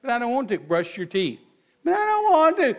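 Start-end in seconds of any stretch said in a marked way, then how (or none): 0.0-0.6 put-on mild whiny kid refusal; a child pleading lightly; child whining. Child refusing a parent, a mild hint of a whine. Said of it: "And they say". 1.8-2.7 high, loud whine on "don't"; a kid wailing "don't" in a high, loud voice; child whining. Retold or imitated. Child refusing a parent again, a high, loud wail. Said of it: "And they say".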